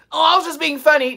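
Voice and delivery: mock the fake apology; mocking the apologizer. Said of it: "and then wanted to apologize".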